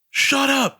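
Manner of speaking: high, loud yelled command; mock yell. Kid in a video yelling at a stuffed animal, mock yell. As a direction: imitate a kid yelling at a toy. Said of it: "like, s-, yelling at it".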